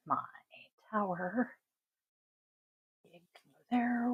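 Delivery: barely-there whisper to oneself; absent-minded whispered self-talk; whispered aside. Real, not acted. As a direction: whisper as if thinking aloud while putting things in place. Muttering to self while arranging items.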